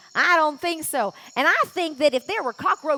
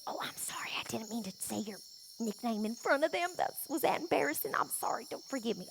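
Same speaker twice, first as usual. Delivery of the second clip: whispered apology to the snake; 6 semitones lower, 14 dB quieter, faster than usual. Acted. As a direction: hush it so the audience won't hear.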